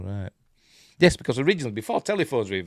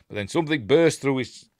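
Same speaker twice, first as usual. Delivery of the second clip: ordinary delivery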